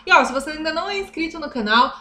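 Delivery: normal talking voice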